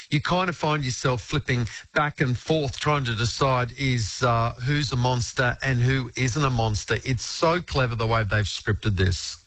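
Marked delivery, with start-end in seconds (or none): none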